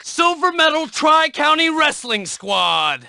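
ordinary talk, nothing marked

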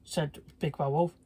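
plain reading voice

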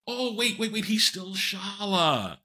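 high 'wait, wait' slowing into a drawn-out finish; dawning realisation. Retold or imitated. Realising the actor is still in character, slow dawning amazement. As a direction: act out the penny dropping.

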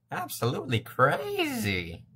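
high, drawn-out wacky voice; mock crazy voice. Shown as a demonstration. Talking to invisible friends, acting absolutely crazy. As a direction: stretch it out high and loony. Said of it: "Yeah, like that".

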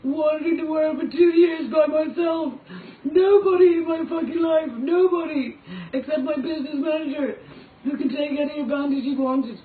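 a raw, tearful shout of hurt; anguished outcry. Real, not acted. Outburst about being abandoned.

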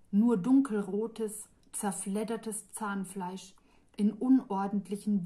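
ordinary delivery, nothing marked

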